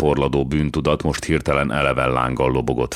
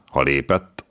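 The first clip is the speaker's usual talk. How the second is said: ordinary delivery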